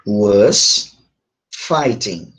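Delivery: low, separated words with a pause between; slow modelling. Shown as a demonstration. Teacher shows the class the verb form, slow modelling. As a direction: set each word apart for learners.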